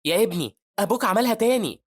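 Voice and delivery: high, loud excited call. Acted. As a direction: call out proudly to a son. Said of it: "وبيسمع جو وهو بينده عليه".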